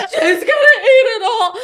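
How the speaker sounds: imitating a bawling kid. Retelling how the kid cried over the pizza, mock bawling. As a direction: play the sobbing five-year-old, hammed up. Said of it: "He starts bawling".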